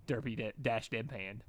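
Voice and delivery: ordinary delivery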